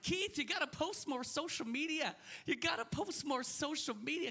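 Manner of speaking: high-pitched imitation of naggers; mock nagging. Everybody nagging him, voiced in joke.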